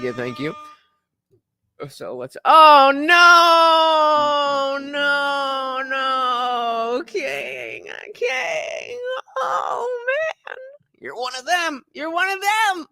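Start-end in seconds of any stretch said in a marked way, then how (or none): 2.4-10.6 high, long-held mock lament; mock wailing. Streamer lamenting to a viewer called King, mock wailing. 11.0-12.9 mock accusation.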